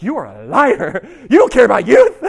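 loud accusation breaking into laughter; mock accusation. Preacher jokingly confronts house-buying friends, laughing mock accusation. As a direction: call them out loudly, laughing as you go.

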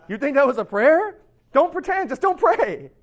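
loud, high, acted outrage; indignant scolding. Jesus scolds people for fake prayer, indignant. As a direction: tell them off hard, as an affronted Jesus.

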